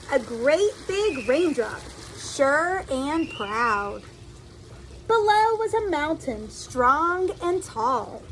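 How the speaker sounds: lively storybook reading voice; reading to children. A narrator's reading. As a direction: read it like a picture book to little kids. Reads a picture book aloud.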